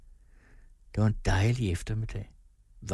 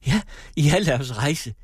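bright, louder, eager yes; suddenly delighted, ready to go; lit up; 6 semitones higher, 7 dB louder than usual. A narrator's reading. Wife agreeing to leave today, lit up and eager. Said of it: "lyste det op i hendes ansigt".

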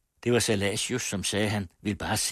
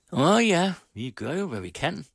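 pleased host; 10 semitones higher, 5 dB louder than usual. A narrator's reading. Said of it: "slog fornøjet manken".

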